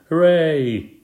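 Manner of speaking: high, loud cheer; drawn-out hooray; shouting. A narrator's reading. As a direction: cheer like a whole busload.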